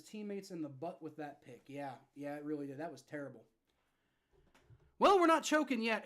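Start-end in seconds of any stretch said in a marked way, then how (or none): 5.0-6.0 frustrated shout. Real, not acted. Fan venting at a bad interception.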